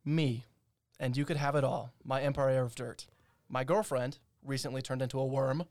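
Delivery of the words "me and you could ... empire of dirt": ordinary talk, nothing marked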